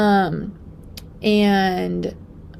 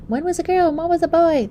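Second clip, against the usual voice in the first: high-pitched mock quote; put-on higher, louder voice for a quote; mimicked listener; 10 semitones higher, 5 dB louder than usual. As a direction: raise the voice high, imitating someone else. Mimics listeners guessing baby genders.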